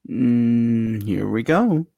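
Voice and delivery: usual speaking voice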